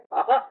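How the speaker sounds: আহা ending in a breathy echoed h; visarga. Shown as a demonstration. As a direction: release a short "ha" puff at the end of আহা. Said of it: "বিসর্গ থাকলে". (আহা-র শেষে বিসর্গের হ-ধ্বনি)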